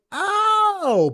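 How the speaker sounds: a high, loud "Oh" of sudden understanding; the penny drops; dawning realization. Retold or imitated. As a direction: friend finally gets the algebra, surprised and high. Friend grasps the algebra.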